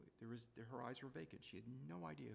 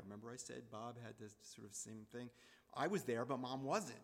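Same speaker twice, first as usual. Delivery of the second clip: ordinary talk, nothing marked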